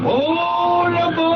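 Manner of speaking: high, loud cry of dismay; alarmed shout. Acted. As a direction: cry out in shock. Inspector reacts in alarm.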